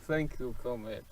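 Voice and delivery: a hint of a Russian accent; low soft Russian accent. Shopkeeper thanks his comrade in a light Russian accent. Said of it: "What accent is that?".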